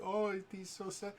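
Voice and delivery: high, far-off girlish voice, mock-sad; hammed-up sad sweet girl voice; sad Jester voice. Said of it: "He's like".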